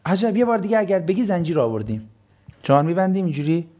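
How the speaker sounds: usual speaking voice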